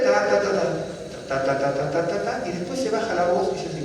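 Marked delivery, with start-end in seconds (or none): 0.0-2.5 rhythmic ta ta ta mimicking fast prayer reading; mock prayer chant in ta syllables; imitated chanting syllables. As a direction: rattle off ta syllables like murmured liturgy. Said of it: "Se empieza a leer".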